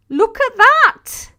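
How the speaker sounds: high, loud, slow and amazed; excited shout. Real, not acted. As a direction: cry it out in delight. Excited reaction to super chat.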